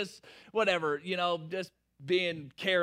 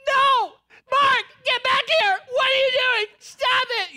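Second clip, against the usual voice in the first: shrieking high and loud at him to stop; full-throated panicked scream, much higher; frantic yell; 17 dB louder than usual. Retold or imitated. Wife screaming at husband to come back, shrill panicked yell.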